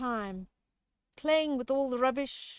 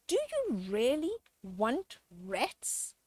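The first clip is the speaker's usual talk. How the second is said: ordinary delivery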